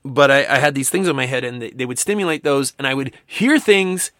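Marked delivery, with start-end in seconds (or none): none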